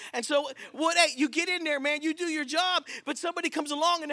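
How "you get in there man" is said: ordinary talk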